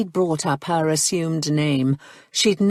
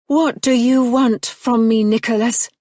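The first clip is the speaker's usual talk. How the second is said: put-on higher, louder, refined English accent; true cultured accent; 6 semitones higher, 4 dB louder than usual. A narrator's reading. Marianne confronts Nicholas in her cultured English accent. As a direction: drop the brogue into cultured English. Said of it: "she'd spoken in her true accent at last".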